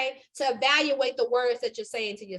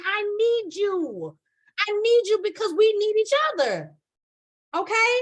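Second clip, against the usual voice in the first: pleading emphasis; 6 semitones higher, 4 dB louder than usual. Real, not acted. Preacher urging the audience.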